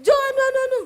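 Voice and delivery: high, loud shouted call across the house; loud Sulawesi call. Retold or imitated. Wife calling her husband to come, high loud shout. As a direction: yell for the husband, pitch soaring high. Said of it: "akhirnya besok keceplos lagi".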